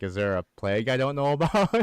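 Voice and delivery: smartass. Retold or imitated. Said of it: "so I was kinda being a smartass".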